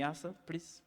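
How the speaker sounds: ordinary delivery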